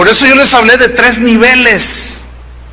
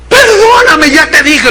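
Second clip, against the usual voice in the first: crying, much higher begging voice; desperate husband sobbing for forgiveness; sobbing plea; 9 semitones higher, 4 dB louder than usual. Retold or imitated. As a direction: beg through tears, voice cracking high.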